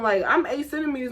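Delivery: normal talking voice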